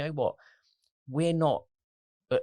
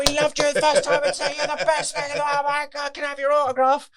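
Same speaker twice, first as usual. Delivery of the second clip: much higher, louder star-struck fan; gushing fan; 7 dB louder than usual. Retold or imitated. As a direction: gush like a suddenly won-over audience member. Audience gushing after TV clip.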